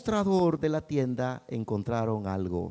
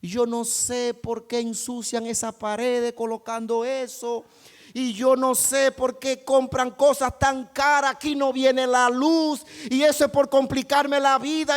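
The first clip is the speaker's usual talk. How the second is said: high, loud grumbling rant at the family; complaining shout; 11 semitones higher, 5 dB louder than usual. Acted. Pessimistic son yelling about the wall sign. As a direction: yell out the grievances one by one.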